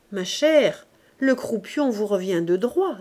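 ordinary delivery

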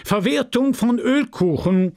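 high-pitched formal proclamation; chairman calling out. A narrator's reading. Chairman announces a farming category to the crowd, calling out. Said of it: "sprach der Vorsitzende".

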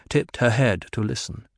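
ordinary delivery

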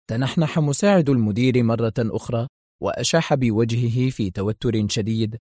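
ordinary delivery

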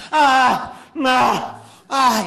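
loud high groans; fake pained cries. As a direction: ham up dying groans. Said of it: "Y empezó la vieja".

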